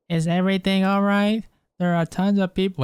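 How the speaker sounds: put-on high girly voice; attempted girl voice. Streamer voices unvoiced game lines for chat, attempted girl voice. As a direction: strain up into a bad girl voice. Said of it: "I can't do a fucking girl voice".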